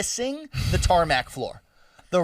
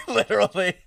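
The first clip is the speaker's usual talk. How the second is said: laughing; 5 semitones higher, 4 dB louder than usual. Real, not acted. Laughing about dragging Dylan.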